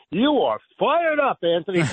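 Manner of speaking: quoting Piscopo. Retold or imitated. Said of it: "in Joe Piscopo's words".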